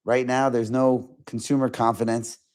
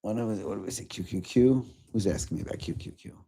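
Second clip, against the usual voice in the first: low, soft aside; muttering to self; 3 semitones lower, 8 dB quieter than usual. Real, not acted. Streamer trying to recall a viewer's question, muttering to self. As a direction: think aloud under your breath.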